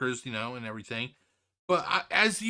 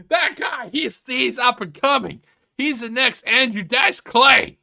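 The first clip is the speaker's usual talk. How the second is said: much higher, slow, sarcastic gushing with a laugh; mock the fans praising a terrible joke; 6 semitones higher, 9 dB louder than usual. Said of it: "everybody like".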